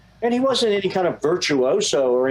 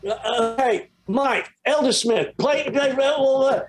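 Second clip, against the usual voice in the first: high-pitched imitation of an English accent; 10 semitones higher than usual. English producer calling to drummer in the studio, high mimicked English accent. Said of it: "in his English accent".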